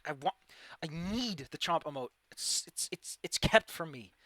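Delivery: normal talking voice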